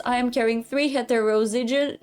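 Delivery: ordinary talk, nothing marked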